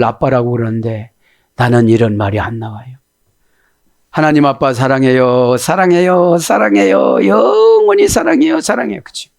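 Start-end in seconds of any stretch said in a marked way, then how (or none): 4.1-9.0 cooing "I love you" that climbs higher; acted cutesy affection, rising into a high squeal; childlike aegyo. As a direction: coax like a child sweet-talking a parent. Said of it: "자식들이 애교를 부리면".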